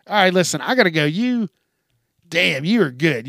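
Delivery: normal talking voice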